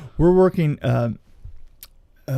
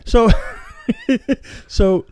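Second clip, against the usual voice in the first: laughing; 12 semitones higher, 8 dB louder, slower than usual. Real, not acted. Joking denial after teasing.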